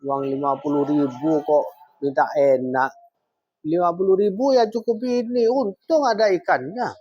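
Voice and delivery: omelan istri ke suami, nada naik makin tinggi; istri kasar. Acted. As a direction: scold your husband harshly, pitch climbing as the complaint goes on. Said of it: "perkataan kasar seorang istri kepada suami". (istri mengomel kasar)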